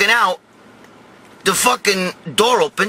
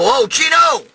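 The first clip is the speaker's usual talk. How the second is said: higher, drawn-out holler of a name; calling out; 6 semitones higher, 3 dB louder, slower than usual. Retold or imitated. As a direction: holler for someone behind a door. Calling to cousin in the stall.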